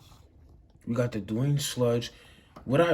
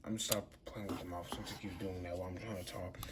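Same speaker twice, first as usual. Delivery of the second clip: quiet aside; 3 semitones lower, 10 dB quieter, faster than usual. Real, not acted. Distracted aside while handling toy.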